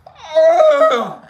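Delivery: loud, high exaggerated pained cry; mock cry of pain. Patient stuck by the needle, loud mock cry. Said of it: "and I cried out".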